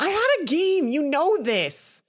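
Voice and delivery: indignant shout, last words slowed; angry retort. Acted. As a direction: fire back, stung and defensive. Heated quarrel with roommate.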